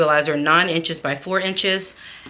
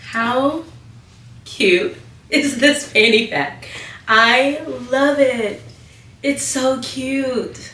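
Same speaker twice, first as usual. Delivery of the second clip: excited gushing; 7 semitones higher, 3 dB louder, slower than usual. Real, not acted. Gushing over the bag, high.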